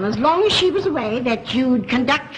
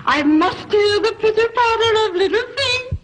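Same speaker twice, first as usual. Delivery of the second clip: yearning plea; 8 semitones higher than usual. Acted. Mother longing for little feet, high yearning plea. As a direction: plead with rising pitch and stretched words.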